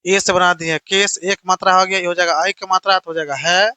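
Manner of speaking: ordinary delivery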